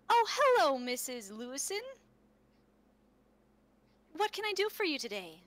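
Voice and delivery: high, bright-pitched hello to a visitor; a touch of shopkeeper welcome; cheery greeting. Acted. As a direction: greet a customer in a lifted, lilting voice. GM voices Jess greeting visitor.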